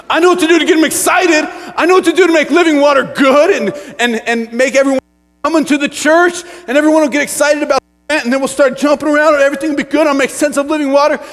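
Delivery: high, loud, eager boasting; overeager, hammed-up enthusiasm; hyped-up youth pastor. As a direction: pitch the big plan with mock excitement. Acting his younger self hyping budget.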